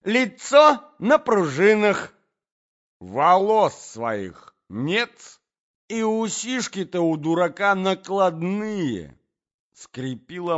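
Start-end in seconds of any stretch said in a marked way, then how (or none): 0.0-9.2 loud, high, drawn-out insults; raised mocking tirade; abusive quarrel. A narrator's reading. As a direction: heap ridicule on the prince.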